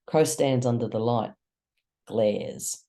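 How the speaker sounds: plain reading voice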